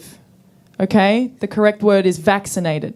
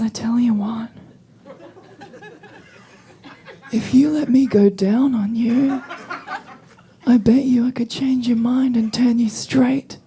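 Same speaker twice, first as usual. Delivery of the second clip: retold sleazy whisper; leaning-in whisper. Bar patron leaning in to proposition her, whispered. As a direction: murmur it close, like a proposition. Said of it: "And he leans in and he says".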